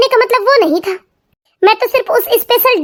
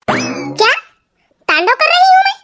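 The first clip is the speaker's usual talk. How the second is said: indignant surprise; 9 semitones higher than usual. Acted. Wife repeating her husband's insult back, offended. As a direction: pitch jumps up in outraged disbelief.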